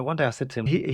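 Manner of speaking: ordinary delivery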